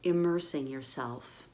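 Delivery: calm meditation voice, long pauses between phrases; guided meditation. Real, not acted. Guide settles listeners into their physical bodies, calm meditative. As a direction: gently lead them into the body, unhurried.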